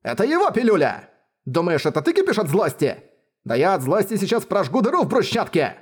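high, furious ranting; berserk rage. A narrator's reading. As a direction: rage out of control. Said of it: "гневную отповедь".

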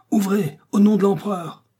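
high, loud commanding shout; terrible roaring voice. A narrator's reading. Soldier demanding entry at the door, thunderous shout. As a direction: bellow the order through a shut door. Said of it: "Une voix terrible gronda".

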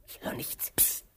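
tense whisper; strained listening whisper. Acted. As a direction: hush him while listening. Listening for a sound.

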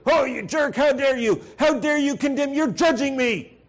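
high, loud offended squeal; mock indignant outburst. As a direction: play the outraged driver, high and loud. Acted indignant reply to warning.

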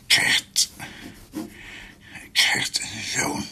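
fluisterend, ijlend van de pijn; dying delirium. Acted. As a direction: ijl zacht als een stervende. Dying man's delirious whispered vision. (ijlend gefluisterd)

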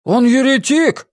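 high, drawn-out accusation; accusing villager. A narrator's reading. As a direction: call it out from the crowd. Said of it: "повторил кто-то из жителей деревни".

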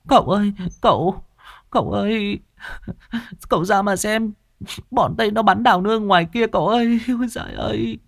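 high wailing sob-voice; whimpering cry. Acted. Servant girl crying to the sick young master, high whimpering. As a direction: wail to the young master through tears. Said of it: "mếu máo khóc".

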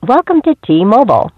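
ordinary talk

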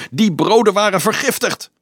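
sudden high shriek. A narrator's reading. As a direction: shriek the accusation at the crowd. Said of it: "gilde dom Anselmus plotseling".

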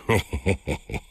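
sniggering little chuckle; dwarf's chuckle. A narrator's reading. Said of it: "Grinnikte hij". (grinnikend lachje)

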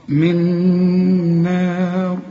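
من نار very drawn out, final raa heavy; recited with heavy raa. Shown as a demonstration. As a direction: chant من نار slowly, ending on a heavy raa. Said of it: "إذا وقفت عليها فخمها".

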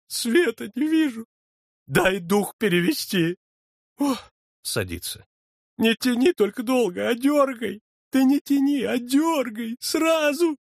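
high pleading insistence; frantic urging; desperate demand. A narrator's reading. Дьячок требует дёргать. (высоко и отчаянно)

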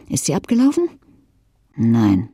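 ordinary delivery, nothing marked

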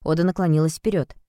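usual speaking voice

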